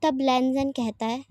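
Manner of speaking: ordinary delivery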